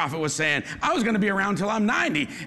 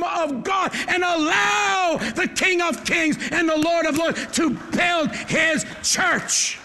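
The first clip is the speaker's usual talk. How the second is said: preaching; 6 semitones higher, slower than usual. Acted. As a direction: hammer each word to the crowd. Preaching at full force.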